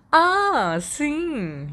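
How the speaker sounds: high, bright Ah sim with a grin; grinning. A narrator's reading. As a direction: tease it out smiling. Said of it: "Sirius sorriu".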